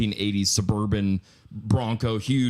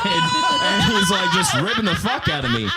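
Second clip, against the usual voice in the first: loud laughter; 8 semitones higher, 6 dB louder than usual. Real, not acted. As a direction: shout of laughter, high and loud, spilling over the words. Laughing while retelling.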